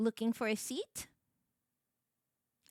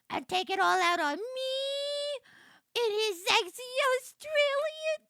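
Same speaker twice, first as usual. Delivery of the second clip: rising to a higher, louder frenzied shout; building excited yell; 14 semitones higher, 9 dB louder than usual. Real, not acted. Streamer ranting about the hero's accent, building to a shout. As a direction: wind yourself up into a squealing yell.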